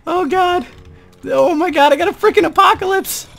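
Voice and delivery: high, tense panic; panicked. Real, not acted. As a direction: fret frantically about dying. Close to dying in game.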